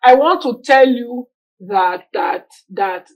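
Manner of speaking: halting, repeated word; imitated stutter. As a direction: imitate a stutterer struggling through a line. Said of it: "some line, like".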